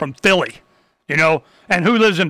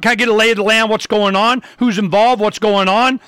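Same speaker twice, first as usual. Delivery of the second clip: normal talking voice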